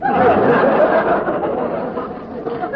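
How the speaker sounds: high breathy laugh. Real, not acted. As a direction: break up laughing. Laughs at the joke.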